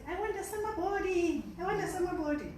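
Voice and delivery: high, pleading mock chant; whiny wish. Girls wishing for a summer body, high mock whine. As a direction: beg for it like a girl who wants to be slim. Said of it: "we start to say".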